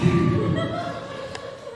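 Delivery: laughing high at the end of the joke; high burst of laughter. Real, not acted. Laughs at own punchline.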